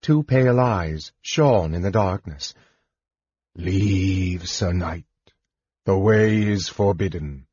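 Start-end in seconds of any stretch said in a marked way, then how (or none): 3.5-7.5 slow, eerie monotone; spectre. A narrator's reading. Spectre bars the knight's way, eerie warning. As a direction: intone it like a forbidding ghost. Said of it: "said the spectre".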